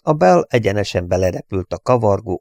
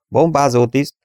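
raised, brisk crew roll-call answer; higher-voiced statement of one's post; roll-call report; 6 semitones higher, 4 dB louder than usual. A narrator's reading. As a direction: announce your station as BOMBÁZÓ TISZT, pitched up. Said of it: "hangzott Wall hangja".

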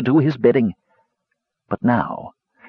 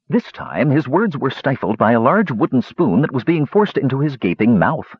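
plain reading voice

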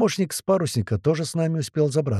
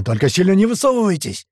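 high, loud warning cry; impatient shout; 8 semitones higher, 5 dB louder than usual. Acted. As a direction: yell a warning to your men. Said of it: "не выдержал Туман".

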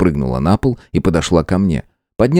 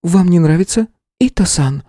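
nervous; 12 semitones higher than usual. A narrator's reading. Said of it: "нервно переспросила девушка".

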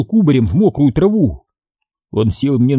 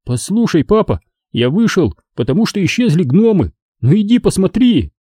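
high-pitched earnest appeal; pleading; 7 semitones higher than usual. A narrator's reading. Boy asks father to check gnomes, pleading. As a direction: coax him to come and look. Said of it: "просил я его".

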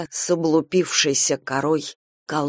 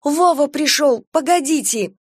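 high, urgent cry; alarmed plea; 9 semitones higher, 4 dB louder than usual. Acted. Kira stopping the old woman, alarmed. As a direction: beg her to stop, urgently.

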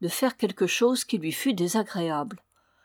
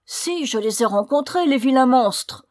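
high, growing louder in outrage; indignant outburst; 5 semitones higher, 6 dB louder than usual. A narrator's reading. Daughter complaining of the dwarfs to her mother, indignant. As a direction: spit out a furious complaint. Cato rages about the dancers.

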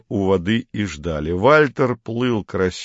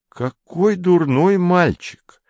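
медленное, высокое театральное восклицание; воскликни с нарочитым ужасом; 10 semitones higher, much slower than usual. A narrator's reading. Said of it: "воскликнул Поль". (высокое протяжное восклицание)

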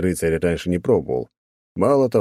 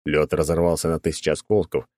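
ordinary delivery

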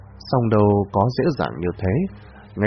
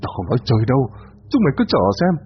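vengeful shout; 3 semitones higher, faster than usual. A narrator's reading. Bound villain cursing his captors, vengeful shout. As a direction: curse them all, voice cracking high. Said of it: "lão hét lên".

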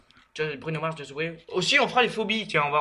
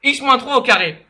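slow loud dictation; 7 semitones higher, 7 dB louder than usual. Pretend. Repeats slowly and loudly to caller.

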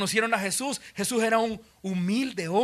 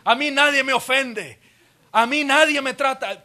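a king's boast, louder and higher at the end; raised voice; 5 semitones higher, 5 dB louder than usual. Acted. A proud king raising his voice at accusers, building loud. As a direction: threaten like an offended ruler. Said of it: "levantado la voz y decir".

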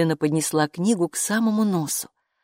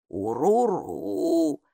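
meaningful grumble; much slower than usual. Acted. Бука, лесное существо, многозначительно ворчит «Уруру» Майке. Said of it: "со значением проворчала она".

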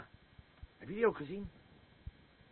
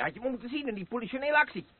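fast, loud, much higher bragging; boastful outburst; 10 semitones higher, 6 dB louder, much faster than usual. Acted. Drunk old soldier bragging to his wife, fast loud outburst. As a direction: rattle off the war boast, swelling up.

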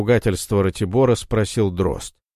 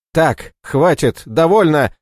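loud, slowed, higher shout; yelling; 7 semitones higher, 5 dB louder than usual. Acted. Мороз затыкает спорщиков, криком. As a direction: cut them off with a roar. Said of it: "заорал Мороз".

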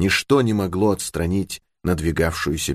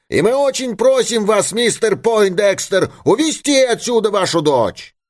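very high, emphatic request; insistent crowd voice; 3 dB louder than usual. A narrator's reading. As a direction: press the father in a high called-out voice. Crowd man urges the father. (настойчивое требование высоким голосом)

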